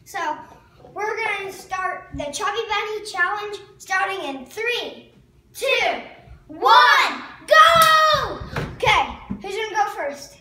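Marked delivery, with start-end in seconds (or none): 0.9-8.4 countdown building to a shout. Real, not acted. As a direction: ramp up to a yelled go. Counting down to the challenge.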